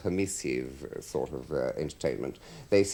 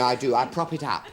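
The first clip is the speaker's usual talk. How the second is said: normal talking voice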